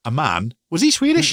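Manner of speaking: a flat "A man?" leaping to a very high, loud "Swedish?"; imitating Kryten. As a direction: squeal the question way up in mock innocence. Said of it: "it's just like".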